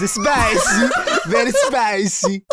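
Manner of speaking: loud, laughing mock Italian accent. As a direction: shout it in a hammy Italian accent. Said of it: "Let's all do Italian accents".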